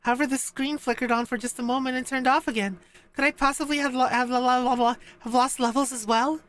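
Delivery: high-pitched character voice; Aris. Acted. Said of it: "oh, this is Aris".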